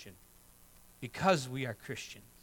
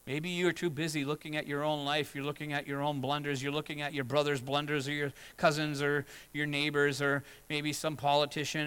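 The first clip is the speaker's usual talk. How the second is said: ordinary talk, nothing marked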